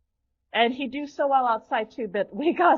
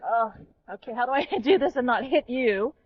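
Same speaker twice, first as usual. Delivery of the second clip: ordinary talk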